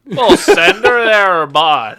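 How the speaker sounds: loud, slow, hammed-up Canadian accent, laughing. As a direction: throw out a big goofy Canadian "We'll send her there". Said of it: "I went to Canadian".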